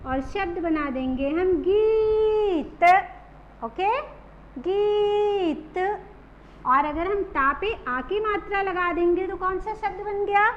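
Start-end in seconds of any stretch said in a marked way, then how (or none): 4.6-5.8 गीत with the ee drawn out slowly; long stretched ee. Shown as a demonstration. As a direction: hold the ee of गीत. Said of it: "लंबा खींचना है". (गीत को धीरे लंबा खींचकर)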